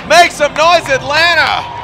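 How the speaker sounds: loud, high call to crowd; rally the arena. Real, not acted. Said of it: "calling out to crowd".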